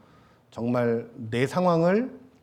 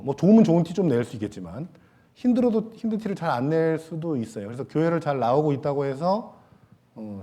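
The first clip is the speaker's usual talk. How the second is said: usual speaking voice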